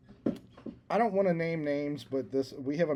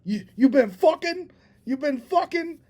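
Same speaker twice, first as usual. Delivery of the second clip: high, loud incredulous blurt; shocked blurt; 13 semitones higher, 8 dB louder than usual. Retold or imitated. Retelling a blunder to a friend, a high loud blurt. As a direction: blurt it out, pitched way up in disbelief. Blurted question, self-quoted.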